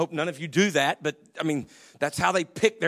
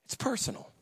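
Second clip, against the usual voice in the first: whispered; 5 semitones higher, 9 dB quieter than usual. Real, not acted. Quiet emphatic sermon point.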